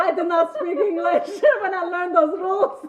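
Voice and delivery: loud, high, breaking into real laughter; laughing confession. Real, not acted. Telling friends why she drives that way, loud laughing confession. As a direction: cry it out through big laughs.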